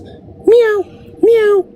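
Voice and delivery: very high, thin imitated kitten meows. Imitating the kitten heard in the wall, high meowing.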